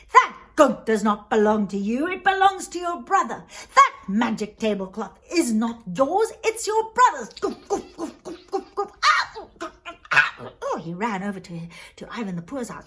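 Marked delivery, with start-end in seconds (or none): 0.1-7.3 scolding cudgels. Acted. Magic cudgels rebuking the thieving brother, scolding. 7.4-10.5 high comic thuds, then yelps; imitated beating. Storyteller acting out cudgels hitting the thief, yelping.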